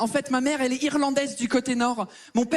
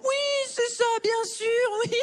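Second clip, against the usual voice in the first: much higher, sarcastic brush-off; sarcastic disbelief; 10 semitones higher, slower than usual. Retold or imitated. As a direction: humour him without believing. Baker dismisses his explanation. (incrédulité sarcastique, très aiguë)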